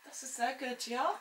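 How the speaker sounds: hammed-up German accent, half whispered at first; a mock German accent, smug and pleased; thick German accent. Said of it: "I just put it on quite thickly".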